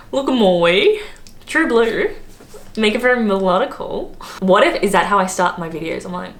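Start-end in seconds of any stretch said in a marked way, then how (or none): 0.1-4.2 put-on broad, singsong Australian accent, pitched up; mock an Aussie drag queen, swooping up at phrase ends. Vlogger imitating a drag queen, exaggerated melodic Australian accent. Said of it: "that's what she's like".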